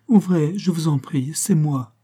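woman begging him to open up, soft and slight; soft little woman's voice. Acted. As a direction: plead gently through the door, light and small. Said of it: "C'était une voix de femme, toute douce, toute fluette".

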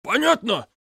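high sharp demand. A narrator's reading. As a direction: push him for an answer. Roommate shoves and demands. (злое требование)

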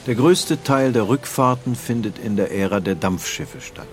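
ordinary talk, nothing marked

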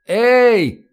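high, stretched-out holler; loud drawn-out call. A narrator's reading. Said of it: "как можно громче протянул Нефёдов".

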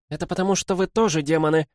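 quick, high, heated outburst; sudden hot-tempered accusation; flaring up. A narrator's reading. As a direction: blurt it out in frustration. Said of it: "вспылила Цзиньвэй".

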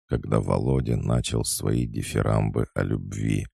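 plain reading voice

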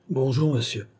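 brief, pedantic greeting; curt and pedantic. A narrator's reading. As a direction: greet him like a dry pedant. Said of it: "de sa voix brève et pédante". (ton bref et pédant)

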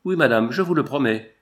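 ordinary delivery, nothing marked

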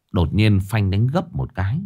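ordinary delivery, nothing marked

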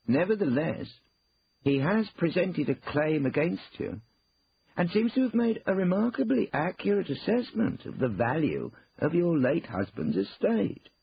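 high, loud, emphatic lawyer; formal lawyer, pressing. Acted. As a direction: deliver the claim slowly and pointedly. Lawyer presses bad news.

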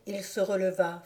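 plain reading voice

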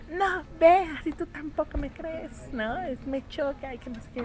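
put-on high, hurt protest of an offended kid; upset child. Child protesting to mother, hurt and indignant. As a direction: snap back like a child who feels doubted. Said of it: "Ella se enoja".

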